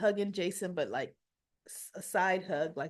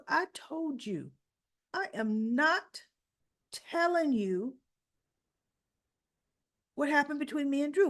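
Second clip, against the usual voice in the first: higher, deliberately spaced retold rebuff; firm refusal; 6 semitones higher than usual. Jason refusing to tell Carly about Drew, firm. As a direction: stonewall a nosy friend, word by word. Acted refusal to pressing friend.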